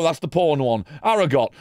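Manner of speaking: normal talking voice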